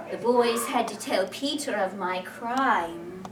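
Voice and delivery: ordinary delivery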